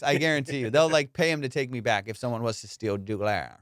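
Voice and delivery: ordinary delivery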